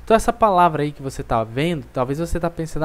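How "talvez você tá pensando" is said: ordinary talk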